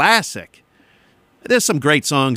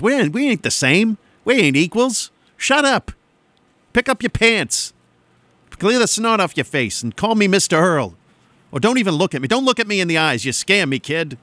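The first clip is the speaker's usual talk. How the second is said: put-on higher-pitched grumpy adult telling off kids; mock scolding; 6 semitones higher than usual. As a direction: put an overfamiliar kid in their place, hammed-up. Acted scolding of overfamiliar kids.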